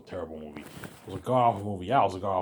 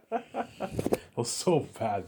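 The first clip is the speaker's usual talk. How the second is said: laughing; 7 semitones higher, much slower than usual. Real, not acted. Laughing over the bad movie.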